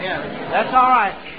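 usual speaking voice

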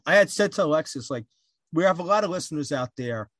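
ordinary talk, nothing marked

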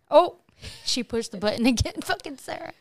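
laughing. Real, not acted. Laughing at soundboard button.

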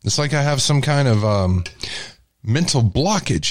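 ordinary talk

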